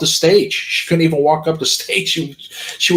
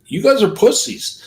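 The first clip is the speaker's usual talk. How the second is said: pitched-up retold challenge; retold yelling; 8 semitones higher than usual. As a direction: re-enact defiant taunting at moderate volume. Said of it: "And I yelled my license plate number".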